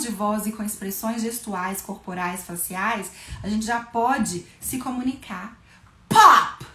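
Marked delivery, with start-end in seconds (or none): 6.1-6.7 a sudden loud, high "Pop!"; loud pop sound. Shown as a demonstration. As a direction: burst it like a balloon. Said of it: "a gente faz com a voz".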